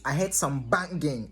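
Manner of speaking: normal talking voice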